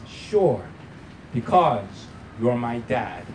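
put-on slow, low, unruffled child's reply; remarkable calmness. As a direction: answer dad with total calm, unhurried. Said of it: "responded with a remarkable calmness".

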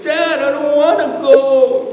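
high, loud, drawn-out wail; mock childish bawling; imitated crying child. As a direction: whine it out like a scared kid begging. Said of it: "he was crying. He was like".

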